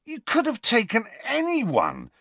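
high-pitched, incredulous outburst; can't believe the choice, voice pitched right up; disbelief. A narrator's reading. Ron questioning his friend over dinner, in high disbelief. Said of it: "said Ron in disbelief".

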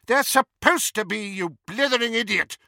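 exasperated shouting teacher; angry shout. A narrator's reading. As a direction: snap it at him furiously. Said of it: "shouted McGonagall".

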